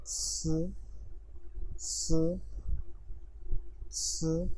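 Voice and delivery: स said as a plain clean hiss; Chinese s sound. Shown as a demonstration. Teacher showing students an easy Chinese sound, clear. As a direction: give स short and even. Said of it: "इसकी pronunciation बिल्कुल easy है".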